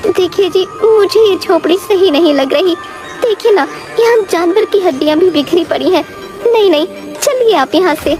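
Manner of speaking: a touch of alarm, urging him away; slightly scared. Acted. Wife uneasy at bones in the hut, slightly scared. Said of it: "थोड़ी डर जाती है".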